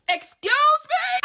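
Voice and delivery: very high, slow, stretched out in shock; high drawn-out outraged excuse me; scandalized excuse me. Real, not acted. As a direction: drag it out high in disbelief. Shocked by Mercedes twist.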